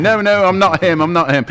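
a flustered crook insisting it isn't him; panicky denial. Retold or imitated. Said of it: "And he's going".

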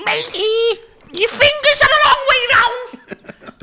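squeaky-high, drawn-out Scouse parody; exaggerated Scouse. Scouse judge nags about fingers, high comic Scouse. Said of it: "Because she's Scouse".

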